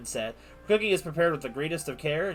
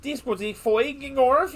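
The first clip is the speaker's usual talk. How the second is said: hammed-up fake Nordic accent, higher and louder; mock Scandinavian gibberish; 9 semitones higher, 7 dB louder, slower than usual. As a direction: bellow nonsense in a put-on Scandinavian lilt. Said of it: "talked the same way".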